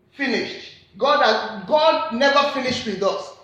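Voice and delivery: ordinary talk, nothing marked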